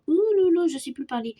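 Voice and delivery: loud high ouh là là; flustered. Real, not acted. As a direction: blurt it out flustered. Flustered exclamation.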